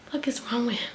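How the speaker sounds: hushed aside to oneself; barely voiced, muttered aside; baffled whisper. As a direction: whisper it in retold disbelief. Baffled aside to herself in story.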